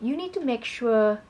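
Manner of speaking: ordinary delivery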